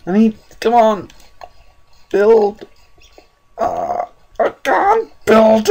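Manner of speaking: very high frantic shrieking; terrified, high-pitched panic; panicked screaming. Real, not acted. Hunted in dark, searching fire.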